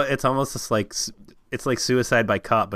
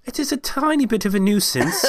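very high, prim apologetic voice; mock politeness; 10 semitones higher than usual. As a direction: tease the timid friend. Mocking timid politeness.

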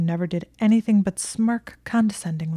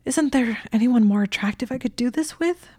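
higher pleading voice, a little louder; begging whine; 6 semitones higher, 4 dB louder than usual. A narrator's reading. Malfoy begging the head librarian for another partner, higher pleading whine. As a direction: beg the librarian shamelessly. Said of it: "Malfoy practically begged".

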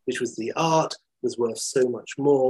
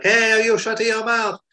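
high, put-on angry police driver's yell; pitched-up mock shout; Italian driver's scream; 10 semitones higher, 4 dB louder than usual. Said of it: "the Italian police drivers scream back".

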